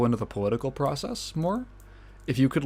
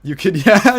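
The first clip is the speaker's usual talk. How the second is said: usual speaking voice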